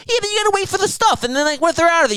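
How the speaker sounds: high whiny mock voice; mocking Anthony. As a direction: whine it way up high like a dumb friend. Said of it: "Anthony's like".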